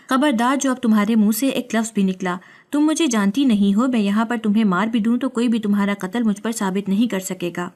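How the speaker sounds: plain reading voice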